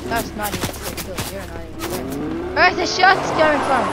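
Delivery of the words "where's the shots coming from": louder, higher alarmed question; louder, higher alarmed call; alarmed shout. Real, not acted. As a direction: panic under fire. Under fire suddenly.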